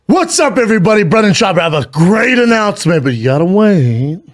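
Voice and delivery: loud, high hyped-up announcement; high energy. Shown as a demonstration. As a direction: copy an over-the-top hype intro. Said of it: "With that energy?".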